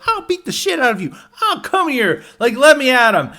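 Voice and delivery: hammed-up high yelling woman; mock shrill threat. Retail woman threatening the man, hammed-up high yell. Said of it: "And then they're like".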